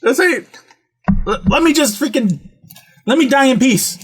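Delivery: high, fed-up retold protest; exasperated plea. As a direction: beg mom to back off. Retold plea to his mother.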